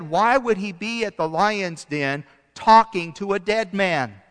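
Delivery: ordinary talk, nothing marked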